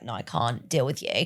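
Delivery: low, muttered brush-off; not so nice. Retold or imitated. Customer brushing off street sellers, low muted dismissal. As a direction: dismiss them under your breath, dropping low. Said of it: "I'm not quite as nice to them".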